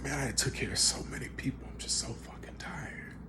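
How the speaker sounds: tearful, worn-out whisper; weary, choked up. Retold or imitated. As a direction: confess it under your breath, near tears. Tearful admission of exhaustion.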